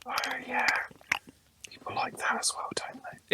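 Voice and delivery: breathy mock ASMR whisper.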